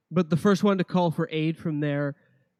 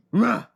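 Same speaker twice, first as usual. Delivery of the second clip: loud troll roar; dopey cartoon bellow; 8 semitones higher, 8 dB louder than usual. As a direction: bellow like a dumb brute. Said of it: "they go".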